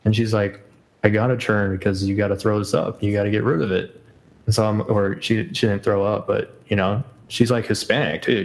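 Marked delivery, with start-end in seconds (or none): none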